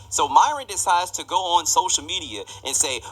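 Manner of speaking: ordinary talk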